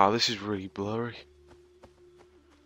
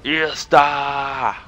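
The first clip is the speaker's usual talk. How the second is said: long mock shout of a name; imitated shout; 3 semitones higher, 4 dB louder than usual. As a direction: imitate a distant yell, drawing out the end. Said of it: "Is he shouting Easter?".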